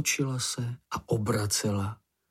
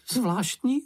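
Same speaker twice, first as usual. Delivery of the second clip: high-pitched character voice; Čenich; 11 semitones higher than usual. A narrator's reading. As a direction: pipe up high above the narration. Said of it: "ozval se Čenich". (vysoký postavičkový hlásek)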